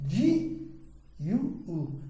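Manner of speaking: "ji", "yu", "u" read separately with pauses; syllable by syllable. Shown as a demonstration. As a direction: spell out the kana "ji ... yu ... u", one at a time. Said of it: "se você for ler parceladamente".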